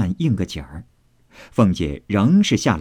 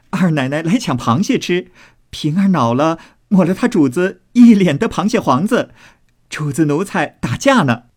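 high-pitched, gleeful announcement; merry calling out; 7 semitones higher, 3 dB louder than usual. A narrator's reading. Maids reporting the crab fight to the matriarch, calling out merrily. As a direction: call across to them, high and merry. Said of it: "忙高声笑回道".

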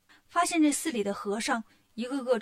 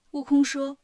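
ordinary delivery

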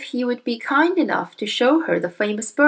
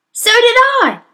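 frightened; 8 dB louder than usual. Acted. Brother frightened.

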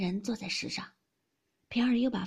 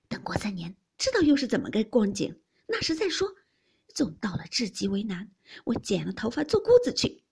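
bitter, emphatic determination; scornful defiance; 3 semitones higher, 8 dB louder than usual. A narrator's reading. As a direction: refuse with mounting indignation. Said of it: "鸳鸯冷笑道".

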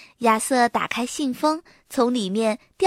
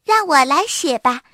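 eager volunteering, pitched up and louder; 自告奋勇; 4 semitones higher, 6 dB louder than usual. A narrator's reading. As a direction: jump in to volunteer. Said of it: "自告奋勇地说". (积极主动地抢着说)

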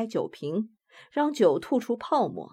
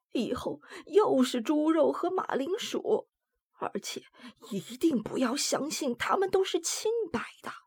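put-on high, hushed old woman gossiping; 5 semitones higher than usual. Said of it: "她压低声音说起那些微妙的事情来".

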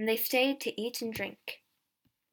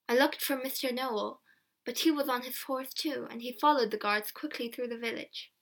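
ordinary delivery, nothing marked

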